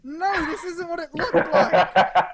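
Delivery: slow, loud, laughing mock denial; hammed-up guilty excuse. Streamer voicing a caught character, mock denial. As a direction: ham up a caught pervert's excuse.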